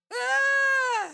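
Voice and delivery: high, scornful laugh; mocking laugh. Dame Aylin mocking the other, scornful laugh.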